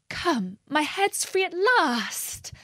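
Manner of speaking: high, drawn-out cry of delight. A narrator's reading. Alice finds her head is free at last, delighted. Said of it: "in a tone of delight".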